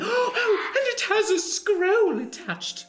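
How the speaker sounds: high, breathless mock excitement; excited. Party member spotting the raven's scroll, excited high voice. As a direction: gasp it out, thrilled.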